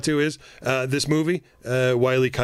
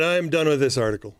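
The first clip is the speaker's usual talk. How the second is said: usual speaking voice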